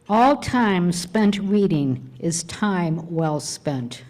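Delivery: slow, deliberate quotation; reading a quote aloud. Real, not acted. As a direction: savour each word of the quote. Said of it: "So why don't you read it to us?".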